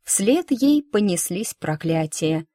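ordinary delivery

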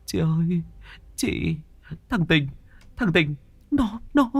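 terrified brother to sister, trembling. Acted. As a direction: barely get the words out. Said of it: "giọng run rẩy".

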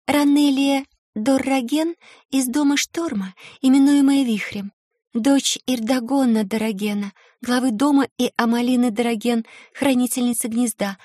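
put-on a shy young girl, lilting and formal; melodic girl's voice. A narrator's reading. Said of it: "Её мелодичный голосок напоминал журчание ручейка".

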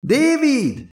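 high, drawn-out call across the distance. A narrator's reading. Elizabeth calling back to the distant android, high shout. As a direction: shout the name to someone far off. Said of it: "Elizabeth visszakiáltott az android felé".

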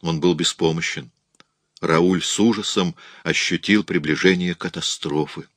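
plain reading voice

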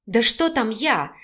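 ordinary delivery

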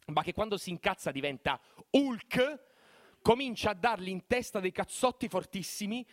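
usual speaking voice

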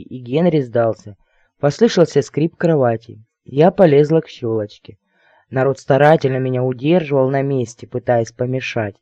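usual speaking voice

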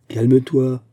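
ordinary delivery

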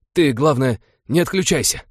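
pressing him to hold on; urgent plea. A narrator's reading. Urging wounded friend to stay awake.

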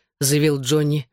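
plain reading voice

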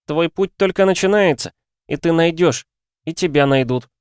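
высокий, громче, звонкий ребёнок; звонко провозгласить как дитя; детский голос. A narrator's reading. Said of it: "снова прозвучал детский голос". (голос ребёнка вещает о пути)

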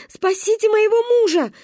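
high-pitched frantic cry for help; desperate yelling. A narrator's reading. Wife running along the water, frantic yelling. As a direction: holler for help in panic. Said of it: "и орать".

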